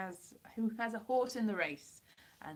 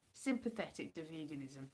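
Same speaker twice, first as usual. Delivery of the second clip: ordinary talk, nothing marked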